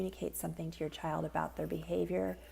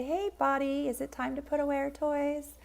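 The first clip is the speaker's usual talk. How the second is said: put-on higher, playful voice to a child; sing-song parent voice; 6 semitones higher, 3 dB louder than usual. Shown as a demonstration. Parent coaxing toddler to tidy toys, higher sing-song. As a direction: lift your voice to coax a toddler. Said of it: "You might say".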